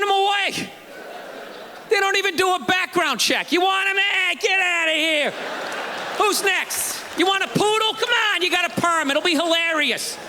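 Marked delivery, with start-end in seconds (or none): 3.5-10.2 brash, higher, carefree shelter guy tossing dogs out; careless pound worker. Retold or imitated. Pound worker waving off a customer, brash and careless. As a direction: hand off dogs like it's nothing.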